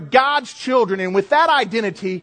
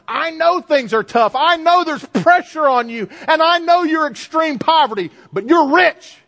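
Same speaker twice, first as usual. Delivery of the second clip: rising, louder and higher, building to the promise; voicing the Lord; 8 semitones higher, 4 dB louder than usual. Preacher voicing the Lord to the suffering church, ringing and raised. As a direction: proclaim it like the Lord consoling them. Lord's words to Smyrna voiced.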